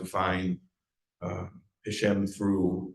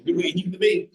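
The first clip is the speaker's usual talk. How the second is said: much higher, louder retold catchphrase; imitating the man's catchphrase; 10 semitones higher, 6 dB louder, faster than usual. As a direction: mimic his insistent verdict. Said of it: "He was always like".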